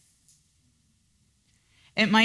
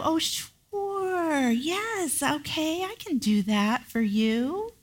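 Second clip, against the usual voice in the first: much higher, softer, sugary yes; fake sweet agreement; 10 semitones higher, 4 dB quieter, slower than usual. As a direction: sweetly agree while meaning no.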